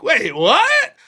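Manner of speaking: loud shocked wait what; startled disbelief; surprised. Retold or imitated. Shocked reaction to porn.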